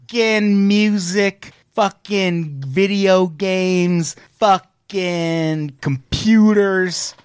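milk every item like a big reveal; dramatic slow listing. Pretend. Mock awards list build-up.

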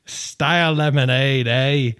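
put-on Aussie product read; best Australian accent. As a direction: read the label with a jokey Aussie twang. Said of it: "Best Australian accent?".

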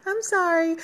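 imitating a child's rising apology; little kid apologizing. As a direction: rattle it off like a small kid begging. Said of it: "when we're little kids".

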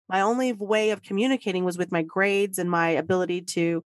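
usual speaking voice

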